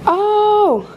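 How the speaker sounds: delighted high "Oh!"; surprised. Real, not acted. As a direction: gasp of happy surprise. Delighted at unboxing item.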